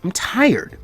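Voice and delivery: pouting. Acted. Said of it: "finishing with a pout".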